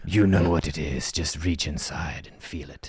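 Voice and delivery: mysterious whispered guidance; whispering ball. Acted. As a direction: coax him in a hushed mystic voice.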